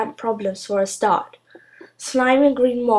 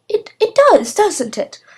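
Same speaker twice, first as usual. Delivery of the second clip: flattered witch; 12 semitones higher, 6 dB louder than usual. Acted.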